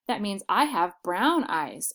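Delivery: usual speaking voice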